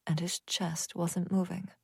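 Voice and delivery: ordinary delivery, nothing marked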